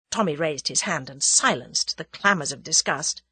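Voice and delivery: ordinary delivery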